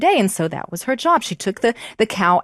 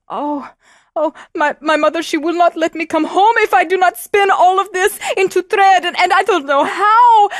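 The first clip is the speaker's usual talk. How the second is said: much higher, louder, near tears; distressed girl; 10 semitones higher, 6 dB louder than usual. Acted. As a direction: plead like a frightened little girl. Girl wailing to the cow.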